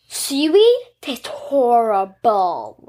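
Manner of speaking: slow loud stress on "horrible"; disgusted by taste. Real, not acted. Complaining about seaweed taste.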